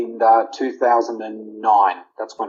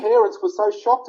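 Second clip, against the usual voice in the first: ordinary delivery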